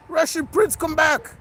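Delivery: loud high call after a cat. Real, not acted. Owner calls loudly after his cat who runs off. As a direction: shout after the cat to come back.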